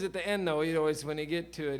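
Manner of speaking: ordinary talk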